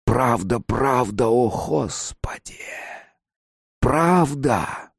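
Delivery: eerie lament with stretched endings; sighing creatures. A narrator's reading. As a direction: chant it like wailing believers. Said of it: "пронеслись вздохи тварей божьих".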